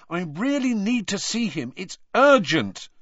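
urgent plea. A narrator's reading. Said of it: "he said angrily".

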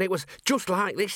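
high-pitched statement from a keen subordinate; reporting constable. Acted. Constable describing the scene to his commander, high and matter-of-fact.

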